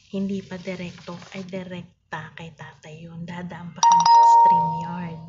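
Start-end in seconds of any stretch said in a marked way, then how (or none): none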